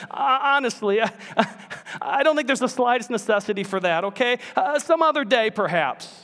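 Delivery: high, fast, flustered backpedal; nervous ghost. Acted. Ghost backing out of the angel's offer, high flustered voice. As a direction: talk your way out of it quickly.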